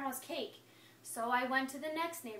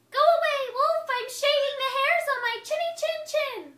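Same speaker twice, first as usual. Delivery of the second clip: much higher, much louder yell; yelling pig; 12 semitones higher, 11 dB louder than usual. Acted. Pig yells at the wolf to go away, loud and high. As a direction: shriek it back through the door. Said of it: "he yelled back".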